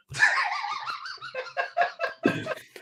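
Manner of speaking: long, high fit of laughter; real helpless high laughing. Real, not acted. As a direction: crack up at the story. Laughs after the story's ending.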